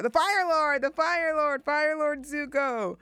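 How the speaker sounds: host remembering the title she forgot, excited; excited realization. Real, not acted. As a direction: blurt it out as the answer finally lands. Finally remembering Zuko's title.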